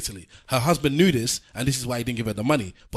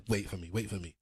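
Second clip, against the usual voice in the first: quiet friend; 5 semitones lower, 12 dB quieter than usual. Retold or imitated. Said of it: "He said".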